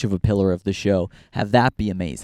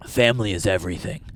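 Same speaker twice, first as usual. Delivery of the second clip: quick, solemn action-hero line, lightly done; a hint of a movie tough guy swearing loyalty; Vin Diesel; faster than usual. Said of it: "real Vin Diesel-esque".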